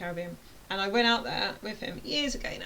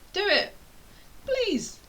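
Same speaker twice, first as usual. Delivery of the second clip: high, loud, pleading burst; urgent plea; 12 semitones higher, 3 dB louder, much slower than usual. Real, not acted. Urging hesitant viewers to build a website, high pleading. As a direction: beg them to just do it.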